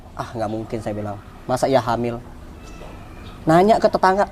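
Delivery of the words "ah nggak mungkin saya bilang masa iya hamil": low, soft doubtful aside; retold private doubt, pitched low; muted disbelief. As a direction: murmur it as if thinking it can't be true. Retold disbelief at wife's pregnancy.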